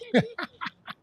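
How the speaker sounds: high-pitched burst of laughter; amused laugh. Real, not acted. Man teasing about his not so funny wife, amused laugh. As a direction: laugh at your own teasing joke.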